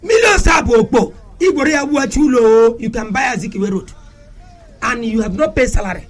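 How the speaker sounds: high-pitched, heated tirade, shouting on key words; impassioned shouting rant. Real, not acted. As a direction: rail against the governors with rising fury. Passionate rant against governors.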